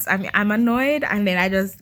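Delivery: ordinary talk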